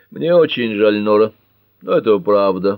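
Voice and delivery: usual speaking voice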